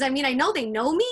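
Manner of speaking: usual speaking voice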